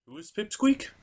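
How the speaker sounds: ordinary talk, nothing marked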